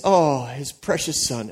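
usual speaking voice

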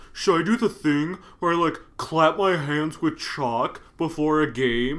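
loud, high, drawn-out mock player; mocking imitation. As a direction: mimic him with exaggerated slowness. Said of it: "he's just like".

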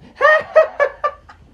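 high, loud burst of laughter; loud mock guffaw. Heckler laughing at the actress, loud high guffaw. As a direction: laugh uproariously at your own heckle. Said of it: "he's just laughing".

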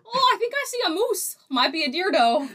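a bad, high-pitched Canadian accent. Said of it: "can't even do the Canadian accent well".

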